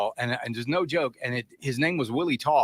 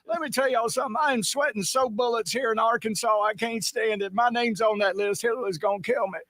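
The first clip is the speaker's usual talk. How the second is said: Southern politician voice; 8 semitones higher than usual. Acted. Politician from Arkansas, playful.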